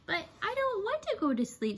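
put-on a small child resisting bedtime; young tortoise. A narrator's reading. Shelley pleads with her parents, in a lightly voiced child's tone. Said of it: "said Shelley".

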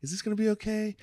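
mock your own old nerves; imitating anxious self.

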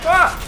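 sharp frightened yelp, high and loud; real jolt of fright, yelled; startled scream. Real, not acted. Jump scare in horror game.

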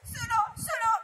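tearful outburst. Acted. Mowgli sopraffatto, scoppia.

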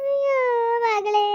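high, drawn-out tearful cry; keening, high-pitched lament; crying wail. Acted. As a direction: wail out in grief. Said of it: "ಅಯ್ಯೋ ಸುಮ್ಕಿರಿ ಹೇಳ್ಬೇಡಿ".

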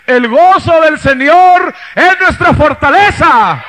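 slow, loud proclamation of joy; triumphant shout. Acted. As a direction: shout it from the top of the wall. Nehemiah proclaiming on the wall.

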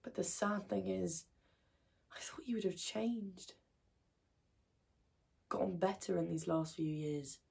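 very soft, with long pauses and a sigh; quiet disappointment. Acted. Activist giving up on his changing, quietly sad. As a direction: let it fade almost to a whisper.

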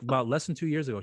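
usual speaking voice

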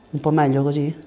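normal talking voice

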